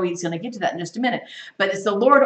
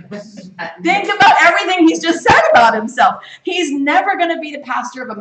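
higher, louder emphatic appeal; preaching push; 8 semitones higher, 7 dB louder than usual. Real, not acted. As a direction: push it out to the whole room. Preacher presses the congregation.

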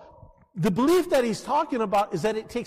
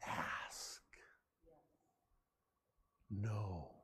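a single breathy hushed word; whispered command; 10 semitones lower, 17 dB quieter than usual. Acted. Pastor to congregation, whispering the instruction. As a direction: whisper it like a secret.

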